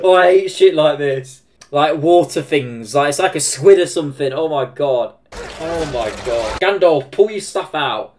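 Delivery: loud, real disgust at slimy things; squirming loud complaint. Real, not acted. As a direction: groan it out like it makes your skin crawl. Loud disgust at the squid monster.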